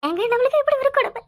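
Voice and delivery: high-pitched acted wailing; heaving sobs. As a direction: wail helplessly, shaking with sobs. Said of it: "குலுக்கி குலுக்கி அழுவுற".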